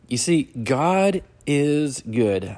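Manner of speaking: high and loud, drawn out; emphatic and slow. Acted. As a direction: proclaim it to the church. Preacher declares key truth.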